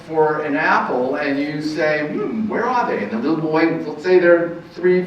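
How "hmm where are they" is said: higher, playful wondering; playful parent voice; mock puzzled. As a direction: pretend not to know, teasing a toddler. Parent playing puzzled with child.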